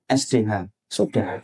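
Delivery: low, clipped one-word answer; husband answering his wife in a single grudging word; curt husband reply. Retold or imitated. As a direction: spell it out flatly, bored.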